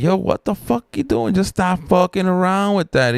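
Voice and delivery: high-pitched hollering up from below; retold yelling. Crew yelling from the basement at the worker, high holler. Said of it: "we were all yelling".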